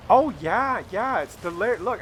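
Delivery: light mock of a teen girl; imitating the young girl. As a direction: toss it off like a carefree teen.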